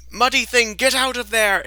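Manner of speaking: high, loud shouted command; Artemas shouting. Acted. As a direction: yell it out in a high voice. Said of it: "he is going to kind of head over here and go".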